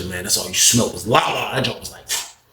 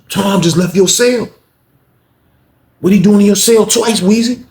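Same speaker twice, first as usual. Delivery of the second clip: higher, louder accusing; accusing inmate voice; 10 semitones higher, 5 dB louder than usual. Retold or imitated. Reese accusing inmate about the guard, high loud accusation. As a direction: press him hard with suspicion.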